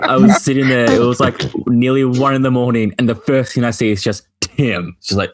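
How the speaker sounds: ordinary talk, nothing marked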